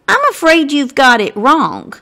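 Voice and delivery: ordinary delivery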